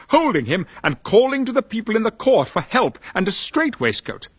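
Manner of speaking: usual speaking voice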